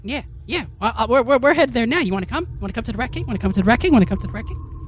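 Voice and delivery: very high squeaky rat, eagerly asking; a rat excited to show the way. Acted. Rat invites players.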